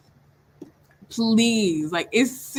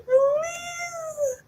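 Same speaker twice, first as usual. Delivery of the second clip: high squealed plea. Real, not acted. Begging the author again.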